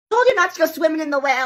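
scolding mom. Acted.

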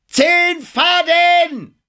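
very high, slow depth call. A narrator's reading. Man at the bow calls out the depth, high shout. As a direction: shout the sounding long and high. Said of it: "rief der Mann am Bug".

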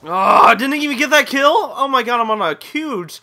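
loud, high exasperated outburst; frustrated. Real, not acted. Gamer to himself after a missed kill, frustrated. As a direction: cry out in exasperation.

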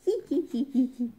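hyena's tittering laugh; string of quick giggles; performed giggle. A narrator's reading. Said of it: "have a laugh".

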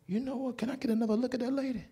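soft whispered request; hushed. Retold or imitated. Usher quietly asking the doctor for another look. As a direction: lean in and ask it under your breath.

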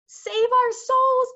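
very high, loud, slow wail; panicked bellow. A narrator's reading. Cowardly giant panicking, high and loud. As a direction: howl it like a frightened brute. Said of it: "bellowed the flesh lump eater".

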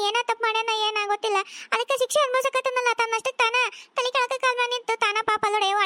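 normal talking voice

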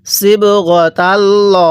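slow, drawn-out tajweed chant; Quran recitation. Shown as a demonstration. Teacher recites the verse for students, slow tajweed chant. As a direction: intone each syllable slowly like a reciter. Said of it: "আমার সাথে পড়ুন".

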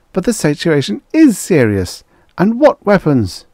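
high, loud and shrill with alarm; alarmed protest. A narrator's reading. Prime Minister protests to the calm Emperor, high alarmed outcry. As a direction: object in frightened disbelief.

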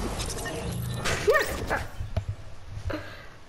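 airy high giggle; breathy laugh. Real, not acted. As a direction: laugh at your own blunder. Ride dopo errore. (risata soffiata)

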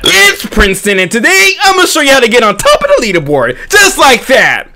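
YouTuber intro. Acted. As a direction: hype up like a channel host.

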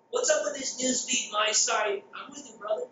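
ordinary delivery

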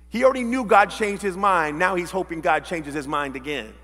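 ordinary talk

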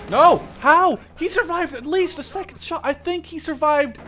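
loud, high cry of disbelief; shocked disbelief. Real, not acted. Sniper whose target survived, crying out in disbelief. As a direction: protest loudly that it can't be. Enemy survives his shot.

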